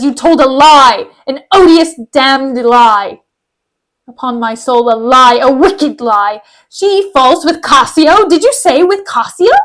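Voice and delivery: slow, emphatic stage fury; theatrical outrage building to disbelief; outraged accusation. Acted. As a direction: hammer out each word, drawn out and indignant. Acted accusation of a liar.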